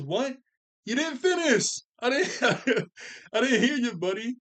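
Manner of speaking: high, loud mocking jeer between laughs; taunting smack talk. Captain America taunting a defeated Ultron bot, loud mocking jeer. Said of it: "he's talking smack to the Avengers".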